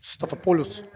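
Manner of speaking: over-the-top Greek accent on the name. Shown as a demonstration. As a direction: roll out Stathopoulos like a proud Greek commentator. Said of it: "have to say it in a Greek accent".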